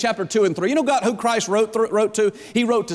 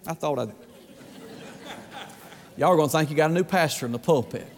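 ordinary talk

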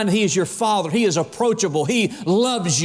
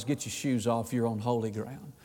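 low, much softer, grave voice of God; solemn divine command; 8 semitones lower, 11 dB quieter than usual. Acted. God warns Moses at the bush, low and hushed. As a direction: hush it down like holy awe.

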